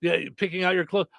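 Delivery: usual speaking voice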